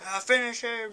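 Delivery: high, loud battle cry; loud, high-pitched command; urgent game shout. Pretend. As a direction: shout it at the fighter mid-fight. Calling out during game fight.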